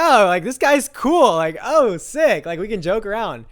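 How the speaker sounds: high, loud, delighted realisation; excited relief. Retold or imitated. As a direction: gush with relief, retelling it. Retells relief that dad is cool.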